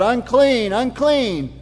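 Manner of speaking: half-hearted town crier's warning; mock yell. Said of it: "that one guy who yells out".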